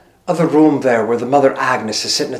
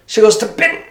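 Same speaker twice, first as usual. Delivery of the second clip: usual speaking voice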